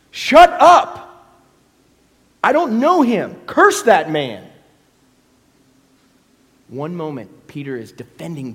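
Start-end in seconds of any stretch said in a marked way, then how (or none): 0.1-1.0 furious yell at the accuser; angry shout. Acted. Peter's denial acted out. 2.4-4.5 loud, slow, cursing the man; angry denial. Acted. Peter's denial acted out.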